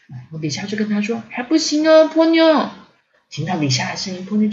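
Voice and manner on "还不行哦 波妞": high, louder teasing call; playful warning. A narrator's reading. Lisa catching Ponyo peeking, warning. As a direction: sing out a caution to a child.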